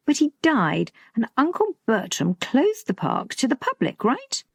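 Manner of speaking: a hint of an insistent daughter; lightly voiced questioning daughter. A narrator's reading. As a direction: build the case, end on a high question.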